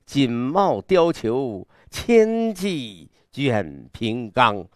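very slow, sweeping declamation; grand, imposing recitation. Shown as a demonstration. Teacher demonstrating the hunt's array, imposing declamation. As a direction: draw every word out to show the grand array. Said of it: "这阵势".

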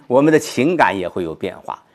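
ordinary talk

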